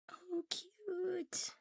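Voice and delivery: a high little squeal of delight; cute squeal. Real, not acted. A cat vlogger squeals at the finished Pikachu, high and delighted. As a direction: melt at how adorable it is.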